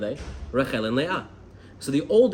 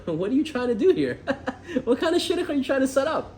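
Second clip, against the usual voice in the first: high-pitched mock indignation through laughter; laughing disbelief; 10 semitones higher than usual. Imagined single confronting the matchmaker over a mafia match, laughing indignantly. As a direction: act incredulous, laughing as the pitch climbs.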